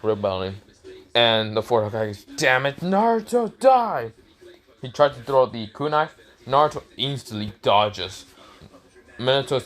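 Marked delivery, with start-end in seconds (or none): 2.2-4.1 high-pitched acted yell; furious attack cry. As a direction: curse him, climbing to a high cry.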